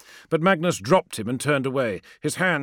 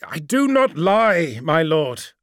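raised, high voice holding back sorrow; slow, loud, pained denial; shouting over a storm; 4 semitones higher, 5 dB louder, slower than usual. Acted. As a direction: call out over the storm, sorrow kept in check. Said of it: "fighting to keep the sorrow from his voice and shouting to be heard over the storm".

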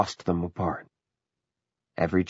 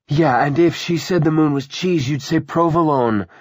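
loud, raised-pitch mockery; scornful jibe; 9 semitones higher, 7 dB louder than usual. Ursula mocking David for agreeing with Willa, scornful. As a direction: throw it at him with open contempt.

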